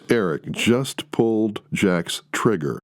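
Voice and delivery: ordinary delivery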